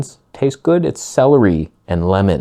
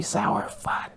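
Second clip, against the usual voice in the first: whispered; 11 dB quieter than usual. Real, not acted. Trailing off about salty juice.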